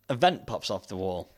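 ordinary delivery

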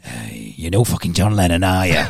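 low mock-pirate scolding; bossy pirate wife. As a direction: order the husband about as a pirate. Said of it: "I think she was a pirate".

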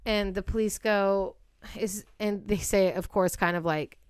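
normal talking voice

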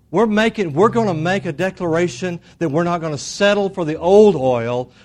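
loud declaration to the congregation; rousing preaching. Real, not acted. Preaches a declaration at full force.

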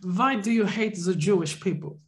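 put-on thick German interrogator accent, vaguely villainous. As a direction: play a movie-villain German shrink. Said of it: "I do the accent".

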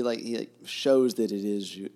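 ordinary delivery

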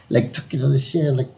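usual speaking voice

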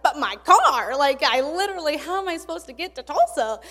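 loud, high, pleading "but my car" that stays high to the end; exasperated protest. Retold or imitated. Claire stranded in Missouri, pleading with God in exasperation. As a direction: throw up your hands at God.

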